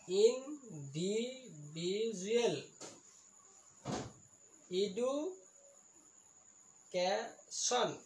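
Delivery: slow, drawn-out syllables with gaps. Shown as a demonstration. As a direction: sound out each long word piece by piece. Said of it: "ইয়াক আমি পলিচিলেবুল বুলি কওঁ". (প্ৰতিটো চিলেবুল ভাঙি লাহে লাহে)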